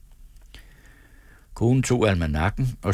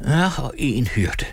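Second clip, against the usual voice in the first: nodding wife; 5 semitones higher than usual. A narrator's reading. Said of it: "nikkede konen".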